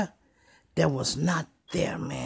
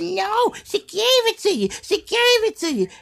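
much higher whiny accusation; whiny accusing girlfriend; 13 semitones higher than usual. Retold or imitated. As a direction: whine the accusation up high.

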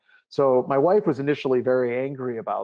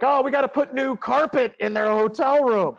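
high, loud put-on holler; imitated high-pitched wife's yell; yelling at husband; 12 semitones higher, 5 dB louder than usual. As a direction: yell an order across the house. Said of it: "she yells at her husband".

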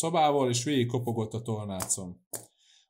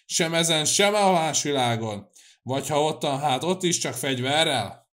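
raised, heated declaration; angry vow; 5 semitones higher, 6 dB louder than usual. A narrator's reading. Cecei swears enmity in this world and the next, angry vow. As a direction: keep the fury up, higher and louder. Cecei's furious tirade continues.

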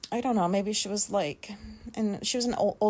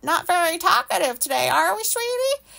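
sugary, much higher mock-sweet voice; condescending cashier; 8 semitones higher, 3 dB louder than usual. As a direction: talk down to her like a child. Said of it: "then she goes".

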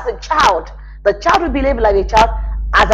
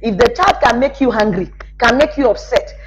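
normal talking voice

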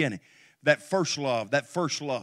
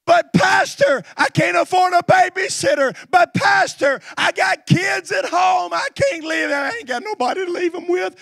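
high, loud mock complaints from the congregation; hammed-up objector pleading; whiny excuses; 13 semitones higher, 8 dB louder than usual. Pastor mocking churchgoers' excuses, loud and whiny. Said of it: "You say".

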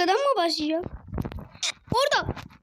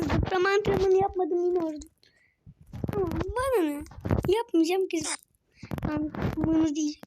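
normal talking voice

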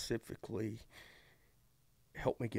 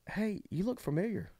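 higher, sweet grandmotherly voice; lightly voiced grandmother asking; old lady voice; 11 semitones higher than usual. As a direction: voice a kind older lady. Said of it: "she goes".

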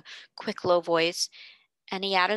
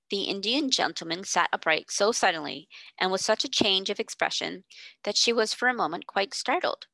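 ordinary delivery

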